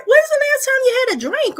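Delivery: loud, high-pitched mock telling-off; scolding self-talk. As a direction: tell yourself off in exasperated sudden realization. Acted scolding of herself.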